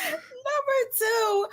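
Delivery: laughing protest. Real, not acted. Guest protests through laughter.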